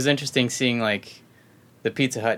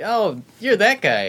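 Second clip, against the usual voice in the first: recognized surprise; 11 semitones higher, 4 dB louder than usual. Retold or imitated. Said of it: "everyone's like".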